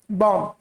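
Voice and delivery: बॉम्ब said as "bom", no final b; silent b. Shown as a demonstration. Teacher repeating the correct reading, silent b. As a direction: close बॉम्ब on the m. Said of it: "बा नहीं आएगा इसमें".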